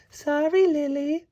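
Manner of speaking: hint of a fairy friend apologizing; lightly voiced Beck. A narrator's reading. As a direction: say sorry as a sweet little fairy. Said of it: "said Beck".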